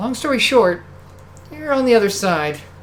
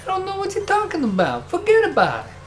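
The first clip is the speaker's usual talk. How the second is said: put-on higher-pitched "forget about it" in a thick New York accent; New York wiseguy; 10 semitones higher than usual. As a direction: play dumb like a mobster. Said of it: "Poisonette, please".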